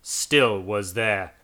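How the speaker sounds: plain reading voice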